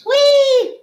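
high, loud gleeful squeal; excited squeal. Real, not acted. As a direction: squeal it out with glee. Excited greeting to hooligans.

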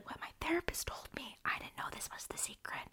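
hushed, voiceless little interjection; whispered aside. Real, not acted.

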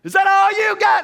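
high, loud repeated jeer; shouting at the angry thrower from the cage; screaming taunt. Retold or imitated. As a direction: goad him every time he throws. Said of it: "I was screaming".

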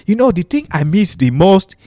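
put-on lightly Nigerian-accented answer. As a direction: stay in a mild Nigerian accent, playing the newcomer. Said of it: "but I'm talking just like this".

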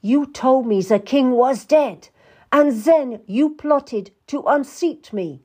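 high, loud, emphatic accusation; bawling out. A narrator's reading. Queen to the Duke who plotted against her, bawling him out. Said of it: "who was bawled out by Anne".

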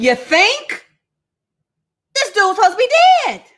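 sarcastic retort. Acted. Disciples' sarcastic fright.

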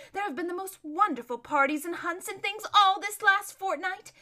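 excited, gushing chatter; airy, lively spoilt-lady voice; gossiping society lady. Acted. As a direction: rattle off court gossip with relish.

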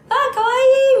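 high, loud excited squeal; imitating a fan squealing at the fairies. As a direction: gush like a fan seeing something cute. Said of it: "みたいな".

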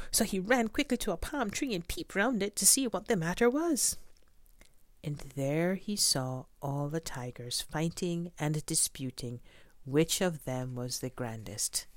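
plain reading voice

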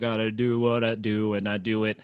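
low, repetitive mock rap. Mocking the repeated rhyme, rapper impression. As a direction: parody a self-important rapper's empty bars. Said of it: "It's like he's so".

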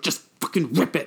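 mock exasperated command; exasperated order. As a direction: imitate an impatient friend urging you on. Retold urgent order while tugging.

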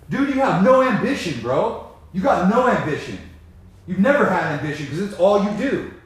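loud, high scolding of an addicted brother; heated rant at brother. Acted. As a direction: lay into him with disgust. Ranting at his brother.

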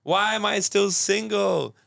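a self-pitying single person asks himself, high and slow; whiny high voice. Retold or imitated. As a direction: ask it like a lost, whining kid. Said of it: "And it's gonna give it to you".

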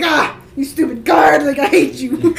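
mock angry shout. Captive noble yelling at the guard to save Silas, hammed-up shout. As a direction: play up fury at the stupid guard. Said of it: "I'm gonna play it up".

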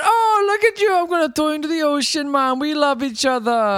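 high, gushing character voice; hammed-up sudden affection, starting high and quick; mock affectionate outburst. Podcaster imitating man spinning his friend around, mock gushing. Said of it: "just changes his whole tone".

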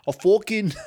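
high, loud New York "fucking", laughing; cracking up in a put-on New York accent. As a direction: lean into a broad New York vowel on "fucking". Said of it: "You, you really went New York right there".

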